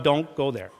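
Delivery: ordinary talk, nothing marked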